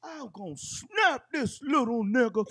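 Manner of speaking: a lightly voiced angry friend; imitating a friend. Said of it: "And he was like".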